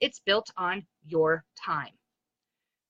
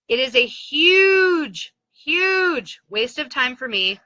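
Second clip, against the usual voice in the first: huge drawn out slow and higher, louder; slow, heavy emphasis on huge, huge; stress on huge; 4 semitones higher, 5 dB louder, slower than usual. Real, not acted. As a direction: stretch out huge, huge to hammer it home. Emphasising wasted time online.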